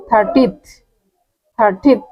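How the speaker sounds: থার্টিথ with a th ending, not থার্টিনথ; careful pronunciation. Shown as a demonstration. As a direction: stress the th at the end of থার্টিথ. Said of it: "থার্টিনথ না কিন্তু".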